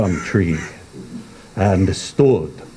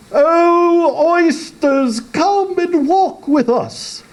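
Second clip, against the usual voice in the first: much higher, coaxing and sweet; beseeching walrus; slower than usual. Acted. Walrus coaxing the oysters, high and beseeching. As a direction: lure the oysters out. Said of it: "the walrus did beseech".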